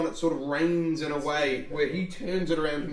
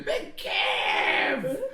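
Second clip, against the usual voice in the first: maniacal scream; 6 semitones lower than usual. Acted. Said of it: "maniacally screaming".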